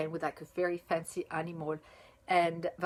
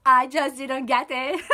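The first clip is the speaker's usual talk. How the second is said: loud, slow, higher, breaking into laughter; laughing admission; 5 semitones higher, 9 dB louder than usual. Real, not acted. Reviewer admitting to viewers they missed it, laughing admission. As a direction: own up through real laughter. Laughing at her own slowness.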